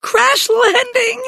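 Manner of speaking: very high, drawn-out squeal; squealing, slow-stretched panic; sputtering. A narrator's reading. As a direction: sputter it out slowly in high alarm. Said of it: "Judy sputtered".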